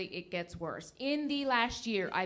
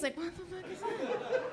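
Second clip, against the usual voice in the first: exasperated aside; 7 semitones higher, 7 dB quieter than usual. Retold or imitated. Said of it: "I'm like".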